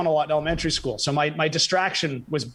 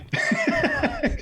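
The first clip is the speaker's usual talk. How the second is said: high laugh. Real, not acted. Laughs at his own joke.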